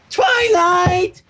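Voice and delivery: high loud excited shout of the name; exclaimed. A narrator's reading. Pinkie bursts in shouting for Twilight, high and loud. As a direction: burst in yelling her name. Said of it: "Pinkie Pie exclaimed".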